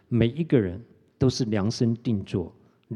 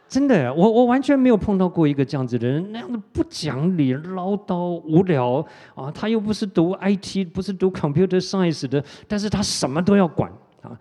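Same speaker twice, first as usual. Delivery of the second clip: higher and louder, fed-up outburst about the boss; exasperated rant; 7 semitones higher, 5 dB louder than usual. Real, not acted. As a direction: vent the frustration, pitch up and loud. Preacher ranting about his boss.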